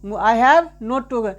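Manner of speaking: slow dictation. Shown as a demonstration. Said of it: "slow teacher dictation".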